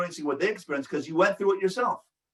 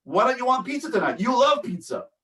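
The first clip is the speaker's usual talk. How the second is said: higher, quicker, pushy disbelief; arguing with the feeling; 8 semitones higher than usual. Shown as a demonstration. Parent arguing with a child who refuses pizza, pushy and higher. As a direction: push back, talking the child out of it. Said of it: "That's different than saying".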